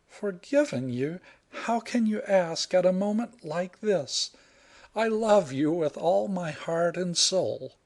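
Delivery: put-on high, earnest young woman's voice; heroine declaring love. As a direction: protest and pour out her love. Heroine's voice held.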